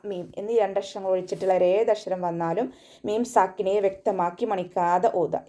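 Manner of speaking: usual speaking voice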